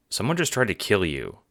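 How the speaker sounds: ordinary talk, nothing marked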